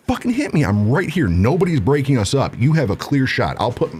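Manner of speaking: high-pitched, heated dare without full volume; mock screaming. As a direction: goad him in an acted angry yell. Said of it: "just screaming at this guy".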